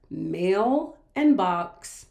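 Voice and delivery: slow, "mail" and "box" set apart; split compound word. Shown as a demonstration. Teacher breaking mailbox into two words, slowly separated. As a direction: pause between "mail" and "and box". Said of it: "we have two different words".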